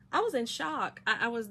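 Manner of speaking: normal talking voice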